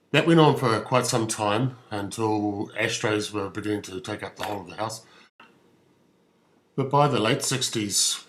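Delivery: normal talking voice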